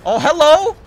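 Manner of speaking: loud, high greeting with a rise; surprised shout. Real, not acted. Player suddenly spotting someone in the game, surprised shout. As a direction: pounce on it with a loud hello.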